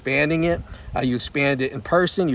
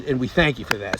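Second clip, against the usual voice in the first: normal talking voice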